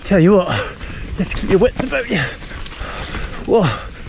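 panting between words; out of breath from pedalling; heavy breathing. Real, not acted. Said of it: "Sorry about the heavy breathing".

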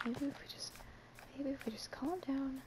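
soft whisper, talking yourself down; whispering to self. Real, not acted. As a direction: whisper to steady your own nerves. Calming herself while lost.

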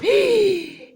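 high playful gasp of fake shock; mock gasp. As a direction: gasp in pretend horror. (şakacı, tiz bir şaşkınlık nefesi)

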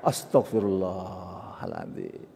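slow, low, soft prayer; hushed, drawn-out repentance; calm istighfar. Shown as a demonstration. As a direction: murmur the prayer slowly and quietly. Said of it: "Nggak connect itu namanya".

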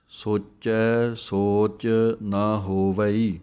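ਸੋਚੈ ਸੋਚ ਨਾ ਹੋਵਈ very slow, full stop at ਹੋਵਈ; pause after ਹੋਵਈ. Shown as a demonstration. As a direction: recite slowly and rest at the end. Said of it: "ਇੱਥੇ ਵਿਸਰਾਮ ਹੈ". (ਹੋਵਈ ਤੇ ਵਿਸਰਾਮ)

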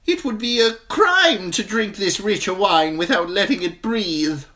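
eager wine merchant. A narrator's reading. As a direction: sell it to the crowd.

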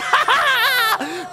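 high, loud witchy cackle; wicked cackle. Acted. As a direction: cackle like a gloating villain. Lady cackling in triumph.

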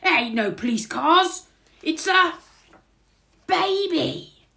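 loud, high exclamation building to the last word; big pause, then amazed shout; astonished burglar. A narrator's reading. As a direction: cry out the discovery. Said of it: "he says".

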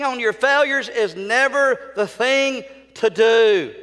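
loud, high sermon delivery; full-force preaching. Real, not acted. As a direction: hammer it out to the congregation.

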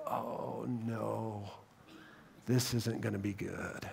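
soft, low dread under the breath; dreading mutter. Acted. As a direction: mutter it as the plot unravels. Nobles dreading Daniel's voice.

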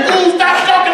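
very high mock outburst; exasperated wife. Wife shutting up her husband, hammed-up high exasperation. Wife exploding in retold argument.

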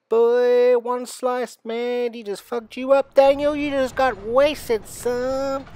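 high, loud mocking shout; loud taunting. Real, not acted. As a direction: jeer at him at full volume. Mocking player after monster hit.

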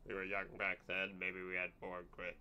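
slow, slurred barfly voice; a slow, heavy drunk voice; drunk man. Retold or imitated. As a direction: drag the words like a tired drunk. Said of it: "he says, 'cause he's drunk".

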